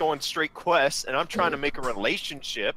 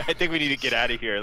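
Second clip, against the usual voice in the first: hurried; faster than usual. Acted. Hurrying to leave.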